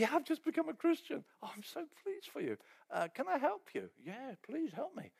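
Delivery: high, bright eager replies; eager new convert. Retold or imitated. New convert answering a helpful church member, eager. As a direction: answer like a thrilled beginner.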